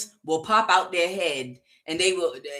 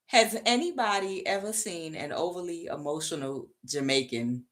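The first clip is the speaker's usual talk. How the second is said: subdued; 5 dB quieter than usual. Real, not acted. Question repeated while recovering from laughing.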